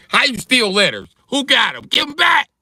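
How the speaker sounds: high, loud demand to give letters back; mock outrage. Comic demanding Mississippi return stolen letters, high indignant yell. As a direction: yell it up high like a robbed man.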